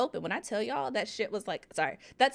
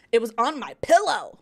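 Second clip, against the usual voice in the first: louder, emphatic protest; indignant outburst; 7 dB louder than usual. Real, not acted. Wife justifying her panic over a spider, indignant outburst. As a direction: burst out in exasperated disbelief.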